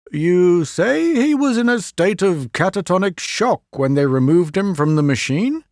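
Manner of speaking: a hint of an older professor asking; Doctor Leo voiced. Leo asking the assistant professor, lightly voiced and puzzled.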